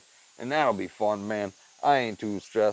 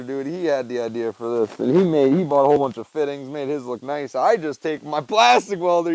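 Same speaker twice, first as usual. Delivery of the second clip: ordinary talk, nothing marked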